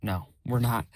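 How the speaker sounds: ordinary talk, nothing marked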